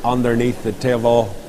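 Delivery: ordinary talk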